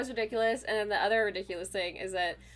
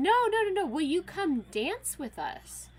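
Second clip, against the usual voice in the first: eager reassurance; 10 semitones higher, 5 dB louder than usual. Retold or imitated. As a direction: plead eagerly, then coax. Friends reassuring frightened woman.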